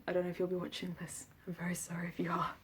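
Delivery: ordinary talk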